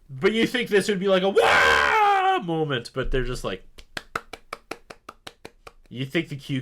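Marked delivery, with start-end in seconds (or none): 0.1-2.4 loud high mock crowd cheer; crowd roar imitation.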